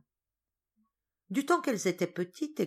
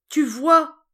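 high, loud frightened cry; 10 semitones higher, 14 dB louder than usual. Acted. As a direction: shout it up in alarm. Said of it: "s'écria mademoiselle Solange".

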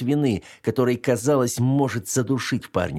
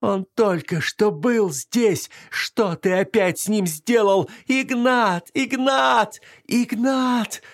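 high, slow sobbing cries; choking on sobs; 12 semitones higher, much slower than usual. Acted. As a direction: sob out the name desperately. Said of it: "задыхаясь от рыданий".

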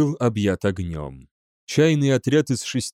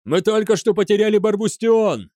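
high-pitched urgent cry; alarmed yell; 9 semitones higher, 4 dB louder than usual. A narrator's reading. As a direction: yell out the bad news. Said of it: "завопил один из наблюдателей". (вопит в тревоге)

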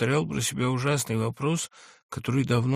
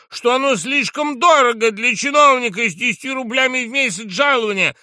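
high, loud accusing tirade; unrestrained fury; 12 semitones higher, 9 dB louder than usual. A narrator's reading. Drunk journalist denounces the general before the guests, unrestrained fury. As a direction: shout each charge with rage. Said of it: "продолжал кричать с неудержимой яростью".

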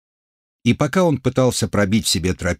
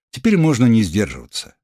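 ordinary delivery, nothing marked

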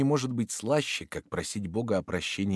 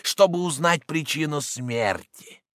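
shouting horror; 12 semitones higher, 8 dB louder than usual. Acted. Roden's shouted horror reveal.

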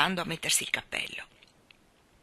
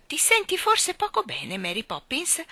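put-on worried child; 9 semitones higher, 4 dB louder than usual. A narrator's reading. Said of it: "si affrettò a domandarle".

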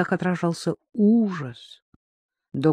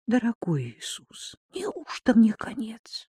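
whispered prayer; 6 semitones higher, 4 dB quieter than usual. Acted. Trapped girl's desperate thought.

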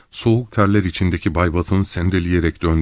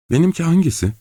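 much higher, anxious question; nervous gulp; 11 semitones higher, faster than usual. A narrator's reading. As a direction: ask after swallowing hard. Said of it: "derken yutkundu".

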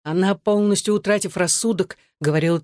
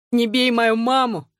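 high, slow child's plea, voiced rather than whispered; tearful plea; 6 semitones higher than usual. A narrator's reading. Boy pleads with his father for his mother, tearful. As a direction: plead slowly, pitched high, a touch of tears. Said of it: "со слезами прошептал Энтони".

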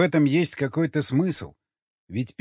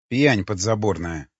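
ordinary delivery

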